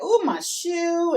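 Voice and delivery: lightly put-on complaint, stretched slowly; feigned shoe trouble. Daughter faking shoe trouble to her mama, playfully put-on. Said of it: "I'm kinda acting like".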